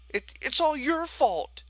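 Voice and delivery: put-on a boy hurling blame; tearful accusation. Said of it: "John declared".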